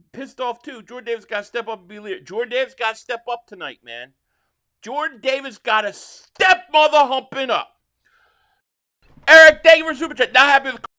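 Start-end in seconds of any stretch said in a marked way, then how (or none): 4.8-7.7 louder, raised, furious demand at the team; boze uitroep. Real, not acted. Angry fan repeating demand, louder. 9.3-10.9 loud, high and fast burst; sudden shout. Real, not acted. Fan erupts reading super chat.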